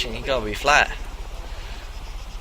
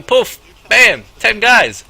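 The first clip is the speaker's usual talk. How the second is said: slow, loud and higher bam; dramatic punch; 7 semitones higher, 10 dB louder, slower than usual. Real, not acted. Pimp telling the listener how ten guys appeared. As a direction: land the twist hard.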